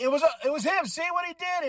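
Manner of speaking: high-pitched, excitable tattling; acted accusation. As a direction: blame him in a high, put-on voice. Acts Chad blaming someone.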